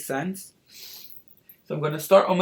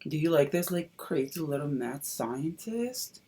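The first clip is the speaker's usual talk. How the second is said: ordinary talk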